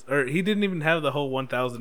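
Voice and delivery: ordinary talk, nothing marked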